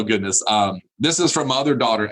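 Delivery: usual speaking voice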